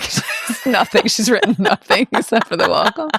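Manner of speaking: talking through real laughter; laughing. Real, not acted. Explaining the joke about her empty speech, laughing. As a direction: crack up while explaining.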